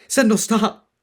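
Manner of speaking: high, loud pleading shout; desperate cry. Acted. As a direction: force the words out, choking. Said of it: "Vax cried, barely managing to cough up the words".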